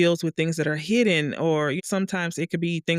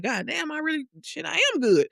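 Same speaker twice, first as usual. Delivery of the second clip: impressed with herself; 11 semitones higher than usual. Retold or imitated. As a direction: gush over your own painting. Impressed with own painting.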